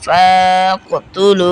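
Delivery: long-held "ফা" before the catch in "ফাআক"; recited "ফাআক, তুলু" with prolonged vowels; three-alif elongation. Shown as a demonstration. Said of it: "তিন আলিফ পরিমাণ টান হবে".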